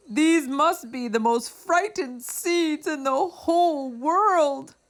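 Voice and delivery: high, worried Toad voice, climbing at the end; Toad's voice. A narrator's reading. Toad frets over his frightened seeds, high and worried.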